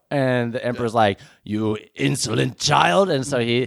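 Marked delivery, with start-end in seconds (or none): 1.4-3.1 slow sinister scolding; Emperor voice. Acted. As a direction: talk down with menace. Emperor scolding Kylo, quoted.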